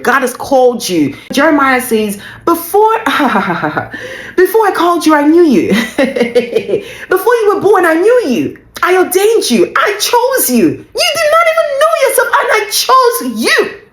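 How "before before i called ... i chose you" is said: high, exultant preaching with bursts of laughter; impassioned scripture quote. Acted. Preacher voicing God choosing the listener, impassioned. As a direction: proclaim it joyfully, pitched way up. Acts out God's words from Jeremiah.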